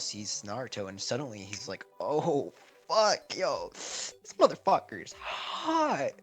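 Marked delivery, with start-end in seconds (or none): 2.0-6.1 rising, drawn-out creepy voice; leering Orochimaru. Retold or imitated. Orochimaru spotting Naruto, slow creepy leer. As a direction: stretch the last words slow and higher.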